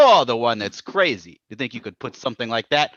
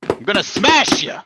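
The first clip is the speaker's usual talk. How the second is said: higher and louder threat; angry threat; 9 semitones higher, 6 dB louder than usual. Acted. Abbott threatens the Raja after the quarrel, with a loud angry threat. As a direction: make him afraid of you.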